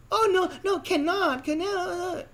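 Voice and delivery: very high put-on squeal of refusal; mock panicked girl. Girl refuses hospital offer.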